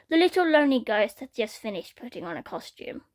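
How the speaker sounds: ordinary delivery, nothing marked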